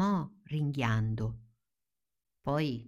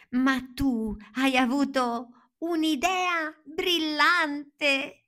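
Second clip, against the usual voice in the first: much higher, slow, wondering; amazed Dorothy; 12 semitones higher, 4 dB louder, much slower than usual. Acted. Dorothy to the scarecrow, high slow wonder. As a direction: marvel at him in a high voice. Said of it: "e sussurrò".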